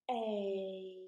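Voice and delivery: A drawn out, gliding as it goes; long vowel A. Shown as a demonstration. Teacher shows children the long sound, A stretched out. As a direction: hold the letter name A long. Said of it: "It's a long vowel sound".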